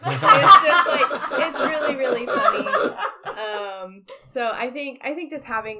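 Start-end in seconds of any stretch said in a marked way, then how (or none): 0.0-2.7 laughing through the words, higher; giggling as she tells it. Real, not acted. Telling friends a funny story, laughing.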